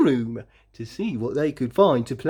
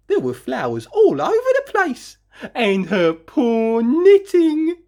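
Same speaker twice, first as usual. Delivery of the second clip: higher, louder dismay at the ruined knitting; dismayed narration; 9 semitones higher, 4 dB louder than usual. A narrator's reading. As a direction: let the dismay rise.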